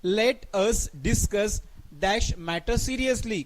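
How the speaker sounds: very slow, word by word reading; slow dictation. Shown as a demonstration. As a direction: read it out slowly for the class. Teacher rereads question slowly. (सावकाश वाचन)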